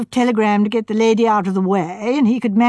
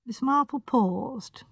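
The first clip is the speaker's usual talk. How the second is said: plain reading voice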